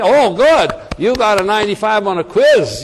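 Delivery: loud, high gush of mock approval; hammed-up maternal praise; delighted mama. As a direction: play an easily impressed mother, voice jumping up. Acting the diverted mother's delight.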